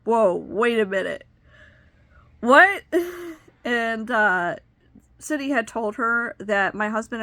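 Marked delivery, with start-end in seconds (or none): 0.0-2.8 stunned whoa. Retold or imitated. To the caseworker who claims she married young, a startled loud What. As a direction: stop the caseworker mid-sentence in disbelief. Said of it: "And I was like".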